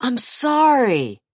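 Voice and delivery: high, slow feigned sorry; false apology. A narrator's reading. Jason to Zach, slow false apology. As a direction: fake regret.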